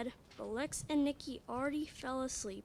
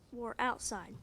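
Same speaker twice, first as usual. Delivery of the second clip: plain reading voice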